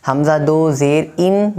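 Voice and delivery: ان with a short, clear i sound; correct short vowel. Shown as a demonstration. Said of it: "آپ کو معروف پڑھنا ہے". (معروف تلفظ: ان چھوٹی زیر کے ساتھ)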